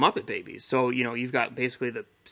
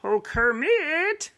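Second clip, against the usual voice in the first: much higher, slow and louder bear wail; Fozzie Bear voice; 6 dB louder, much slower than usual. Shown as a demonstration. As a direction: whine it like a goofy comic bear. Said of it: "Fozzie's kinda like".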